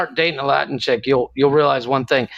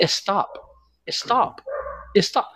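put-on "Stop" as high, softer Spanish-accented "eh-stop"; wife's Spanish-accented "stop"; 6 semitones higher, 5 dB quieter, much slower than usual. Husband imitates angry wife, Spanish-accented "eh-stop". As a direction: add a Spanish-accent vowel before "Stop": "eh-stop". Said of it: "but she doesn't say stop".

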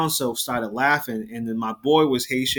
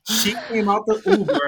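laughing; 7 semitones higher than usual. Real, not acted. Laughing through the punchline.

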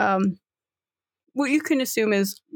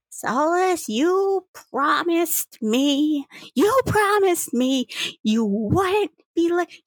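high, drawn-out grandmotherly reproach; scolding grandma; 8 semitones higher, slower than usual. Acted. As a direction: plead with your granddaughter in a raised, slow voice. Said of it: "you can hear her grandma going".

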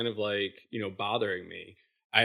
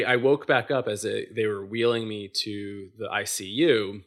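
normal talking voice

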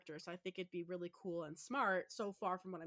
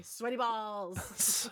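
laughing drawn-out punchline; 3 semitones higher, 7 dB louder, much slower than usual. Pretend. Joking punchline after catchphrase.

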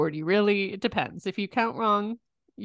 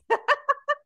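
high laugh. Real, not acted. Laughing at own joke.

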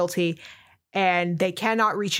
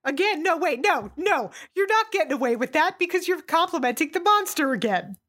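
exasperated protest; 7 semitones higher than usual. Real, not acted. Objects to praise of Fisk.

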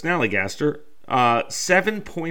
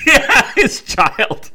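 laughing; 12 semitones higher, 7 dB louder, much slower than usual. Real, not acted. Joking banter, laughing.

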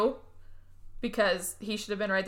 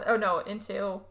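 ordinary talk, nothing marked

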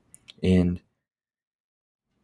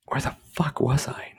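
a joking hushed question to himself; mock lost whisper; 7 semitones higher, 3 dB quieter than usual. Podcaster pretending to forget where he was, whispered. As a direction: whisper it like he's lost his place. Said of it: "No, I'm just kidding".

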